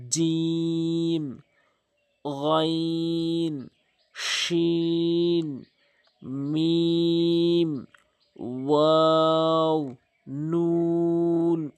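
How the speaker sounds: each letter held long and slow, recitation style; drawn-out letter names. Shown as a demonstration. Teacher chanting letter names for students. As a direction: stretch every letter out evenly. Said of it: "তিন আলিফ করে টেনে পড়বেন".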